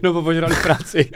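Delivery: cracking up at the joke; laughing. Real, not acted. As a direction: repeat it through real laughter, high and loud.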